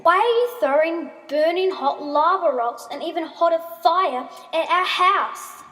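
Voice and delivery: high, loud outraged question; shouted accusation. A narrator's reading. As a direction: shout it across at an enemy. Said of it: "Ascendia shouted at Taryn".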